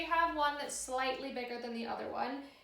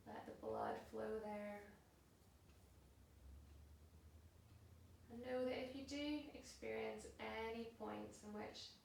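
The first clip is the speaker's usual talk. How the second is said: soft, unhurried yoga relaxation voice; guided meditation; 3 semitones lower, 11 dB quieter than usual. Acted. Yoga teacher settling class into a held pose, soft and calm. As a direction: guide them into stillness, hushed and spaced out.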